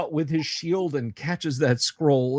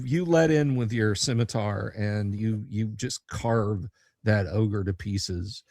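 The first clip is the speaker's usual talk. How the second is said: ordinary talk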